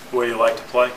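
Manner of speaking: ordinary talk, nothing marked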